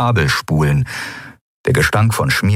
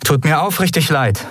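higher, mock-sincere sorry; sugary fake regret; insincere apology; 9 semitones higher than usual. A narrator's reading. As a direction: fake an apology you don't mean. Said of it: "log sie".

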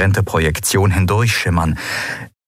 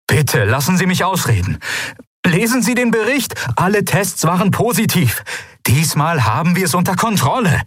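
pleading, much higher voice; pleading adviser; 11 semitones higher than usual. A narrator's reading. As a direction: beg her to hear him out. Galet begs his ruler to listen. (flehend, deutlich höher)

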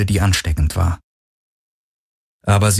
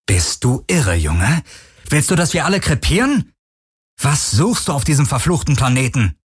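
higher-pitched, exasperated outburst; angry cutting-in; 7 semitones higher than usual. A narrator's reading. Parker cutting Kowalski off to berate Garland, exasperated. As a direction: cut in with incredulous, rising questions. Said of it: "Parker fuhr ihr über den Mund".

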